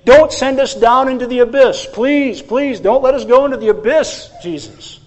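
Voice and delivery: high, loud, urgent pleading; acted desperate demons' plea; begging. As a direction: beg for mercy, dropping off on the last word. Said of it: "And then they begged him".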